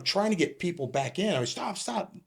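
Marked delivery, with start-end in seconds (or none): 1.5-2.1 high, softened mock call to halt; helpless pleading. New officer trying to get people back in, helplessly. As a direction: imitate a powerless plea, high and small.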